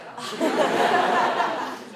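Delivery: loud, high burst of laughter. Real, not acted. Laughs at the joke.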